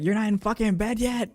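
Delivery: imitating angry mom. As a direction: squeal it like an outraged mom. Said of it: "She was like, she was like".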